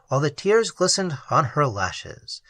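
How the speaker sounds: plain reading voice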